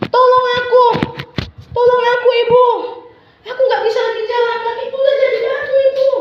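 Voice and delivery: high, loud, fast pleading; frightened crying plea. Acted. Darmi begs her mother to save her, high frightened plea. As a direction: beg mother in panic. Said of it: "makin menangis dan dia jadi ketakutan".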